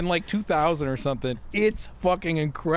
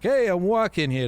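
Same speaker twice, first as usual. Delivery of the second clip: raised, brash New York voice; New York accent; 6 semitones higher than usual. Acted. Whiskey drinker in the New York phase, New York accent. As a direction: put on a pushy New Yorker. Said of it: "it's in the New York phase".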